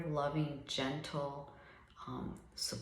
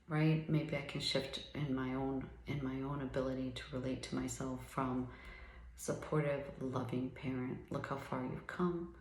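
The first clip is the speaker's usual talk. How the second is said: ordinary talk, nothing marked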